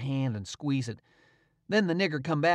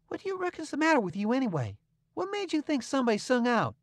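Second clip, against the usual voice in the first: higher, fast stern questioning; kinda severe; 10 semitones higher, faster than usual. A narrator's reading. Tom sternly questioning the frightened servant. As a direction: scold him sharply. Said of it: "kinda severe".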